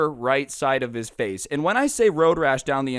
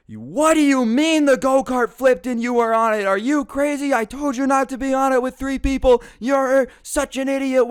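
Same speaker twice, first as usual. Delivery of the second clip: much higher, loud angry mom voice; furious mom yelling; 11 semitones higher, 6 dB louder than usual. Retold or imitated. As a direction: scream at your kid for lying. Mom yelling at caught son.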